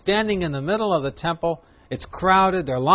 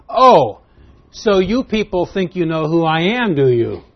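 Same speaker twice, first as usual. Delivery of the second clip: loud, higher-pitched mocking challenge; facetious; 4 semitones higher, 8 dB louder than usual. Jesus answering people who claim to know him, facetious. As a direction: throw it at them in mock amazement. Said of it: "that's speaking facetiously".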